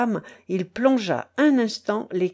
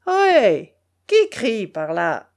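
long, slow hail from afar; loud sentry's call over water; 5 dB louder, slower than usual. A narrator's reading. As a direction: call out a challenge. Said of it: "interrogea de nouveau la voix".

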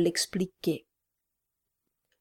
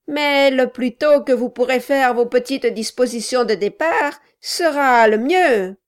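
raised voice; 5 semitones higher, 7 dB louder than usual. Acted. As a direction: raise your voice for a deaf old man. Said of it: "élevant la voix".

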